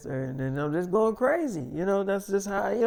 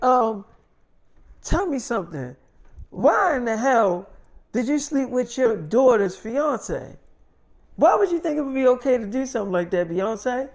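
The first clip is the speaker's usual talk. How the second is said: higher-pitched, scolding interrogation; indignant mother; 6 semitones higher, 3 dB louder than usual. Retold or imitated. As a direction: imitate a mother demanding answers. Mother confronts daughter by phone.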